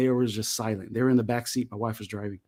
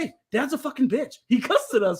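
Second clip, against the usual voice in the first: put-on high little-kid voice, half laughing; a nine-year-old tattling to his brothers, delighted; gleeful kid voice; 10 semitones higher than usual. Kid tells brothers about dad.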